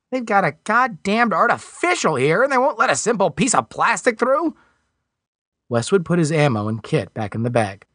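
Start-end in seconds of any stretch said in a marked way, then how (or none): 0.1-4.5 high, put-out Alan voice; indignant young Alan. A narrator's reading. Alan complains to Westwood about the artificial, high and indignant.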